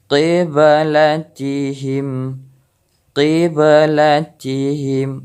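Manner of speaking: slow "qiblatihim" with the b bouncing; qalqalah on ba. Shown as a demonstration. Teacher demonstrating qalqalah, bounced ba. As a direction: echo the ba in "qiblatihim". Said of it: "Contoh ketiga daripada huruf ba".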